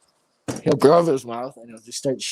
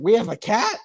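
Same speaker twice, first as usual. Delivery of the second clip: high, questioning grandma voice; imitated confused grandma; 11 semitones higher than usual. Imitating forgetful grandma asked about the cat, high puzzled voice. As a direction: pitch it up in puzzled surprise. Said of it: "Grandma, when did you get the cat?".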